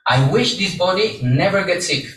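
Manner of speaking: normal talking voice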